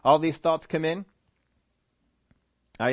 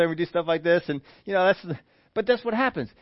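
normal talking voice

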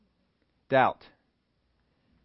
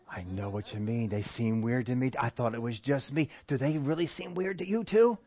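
gossiping agreement; 3 semitones lower, 5 dB quieter than usual. Acted.

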